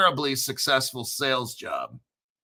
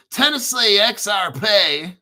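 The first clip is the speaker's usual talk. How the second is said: louder, higher mock Tennessee drawl; Tennessee accent; 5 semitones higher, 6 dB louder than usual. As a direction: draw it out in a hammed-up Tennessee accent. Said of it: "why do you talk like that".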